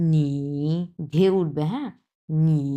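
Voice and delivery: নি dipping down then rising in a V shape; 第三声, 先降后升; third tone. Shown as a demonstration. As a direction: let নি fall then climb, a V-shaped third tone. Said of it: "এইভাবে".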